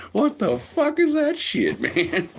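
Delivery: very high, slow incredulous squeal; a squealing what-is-that; squeaky mock disbelief. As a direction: stretch out the shocked question. Own reaction acted, very high.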